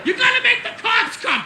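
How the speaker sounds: put-on loud, high-pitched drunken yelling; screaming argument. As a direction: holler back and forth across a parking lot. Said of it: "everybody's screaming".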